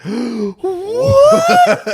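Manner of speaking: put-on a kid's outraged disbelief, shrieked; high gasping cry of shock; shocked shout. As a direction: gasp then a high, loud "What?". Kids told no cake.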